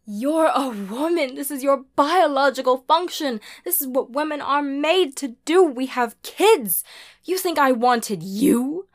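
loud, high, furious tirade; forceful, heated outburst; desperate ordering. A narrator's reading. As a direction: rail at your child, ending in a command. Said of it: "She all but ordered".

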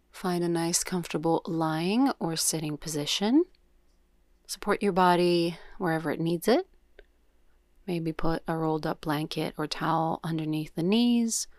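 unhurried yoga nidra guidance with long pauses; guided meditation. Real, not acted. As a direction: guide them gently into breath awareness, leaving space after each cue.

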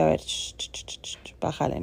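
put-on whispered shh to calm a child; mother whispering to her upset daughter to calm down; shushing daughter. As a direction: hush her softly, shh, shh. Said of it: "Y yo".

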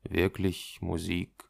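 slow, low, soft repetition; hesitant. A narrator's reading. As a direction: echo it back slowly, unsure. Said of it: "Hunter wiederholt zögernd". (zögernd, langsam und leise)

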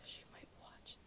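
hushed whisper under the breath; very soft, breathy whisper; whispered aside. Real, not acted. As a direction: whisper it like a secret. Aside about someone who might watch.

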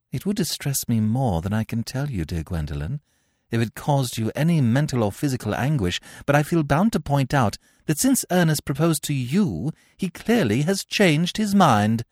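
cool, cutting high-society voice, slowing at the end; haughty young lady. Acted. Gwendolen to Cecily, rising haughty disdain. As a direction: correct her with poisonous courtesy.

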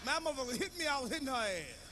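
usual speaking voice

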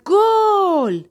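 high, loud triumphant cry of goal; goal shout. A narrator's reading. Boy jumping into his mother's arms at the first goal, jubilant shout. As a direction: leap up and roar the goal. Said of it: "و داد زد".

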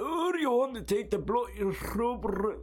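very high, hard-rolled northern English R's; mock northern English accent. Northerner talking to baffled visitor, exaggerated northern English accent. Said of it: "they, like, roll their R's so hard".

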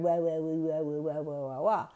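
a lightly voiced muffled dad mumbling down the line; imitated mumbling. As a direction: mimic dad's mumble through static. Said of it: "That's all you heard on the static".

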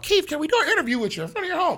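high, fast, bright-eyed interviewer asking; eager interviewer voice. Retold or imitated. Reporter begging Keith for a home interview, high and fast. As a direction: chirp it like a reporter at the door.

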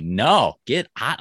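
acted-out recoil. Retelling recoil from soft touch.

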